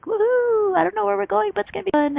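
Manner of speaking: high, thrilled woohoo and a happy promise of fun; excited whoop. Retold or imitated. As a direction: whoop it out like setting off on an adventure. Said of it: "You're all excited".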